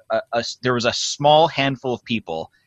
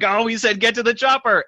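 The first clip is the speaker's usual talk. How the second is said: high excited fan quoting, voice jumped up; mock audience; 11 semitones higher than usual. Audience member recognizing the famous line, high excited voice. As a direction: squeal it like a delighted listener spotting the line. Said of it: "they would be like".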